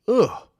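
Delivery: a sharp, high, loud "ugh" of disgust. Real, not acted. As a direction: spit out a disgusted grunt. Disgust grunt after the word.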